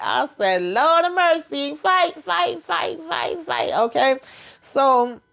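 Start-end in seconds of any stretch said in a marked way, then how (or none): 0.6-3.7 high-pitched, slowed chant of fight; excited cheering. Retold or imitated. Own retold reaction to TV fight.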